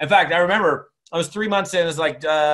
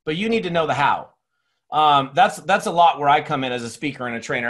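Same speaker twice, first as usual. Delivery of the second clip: ordinary talk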